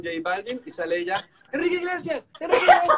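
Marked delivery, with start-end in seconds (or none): 1.5-3.0 much higher, louder laughing call; excited calling out. Retold or imitated. Imitating cohost chasing [name], high laughing call. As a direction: squeal the name as if spotting a star.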